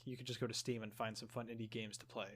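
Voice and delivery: ordinary delivery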